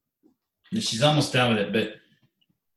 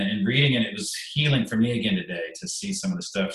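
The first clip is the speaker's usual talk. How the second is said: ordinary delivery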